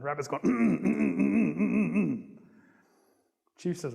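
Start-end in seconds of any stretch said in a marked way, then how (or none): 0.4-2.4 rapid run of throaty little hums; repeated comic mm-mm sounds; eager humming. Acted. Rabbit offering himself as husband, eager humming.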